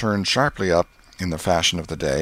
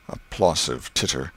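usual speaking voice

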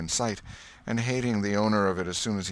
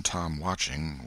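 ordinary delivery, nothing marked

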